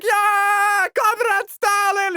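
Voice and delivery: high, loud triumphant cheer; troops cheering. Retold or imitated. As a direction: cheer with the boys. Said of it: "all the boys go like".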